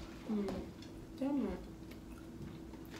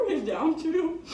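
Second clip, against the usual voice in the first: laughing; 7 semitones higher, 3 dB louder than usual. Real, not acted. Laughing while eating.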